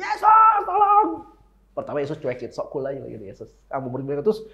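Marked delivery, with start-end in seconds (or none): 0.0-1.3 very high, loud cry for help; acted shout. Blind beggar calling Jesus for help, acted shout. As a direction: holler for help on a high held note. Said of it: "langsung teriak".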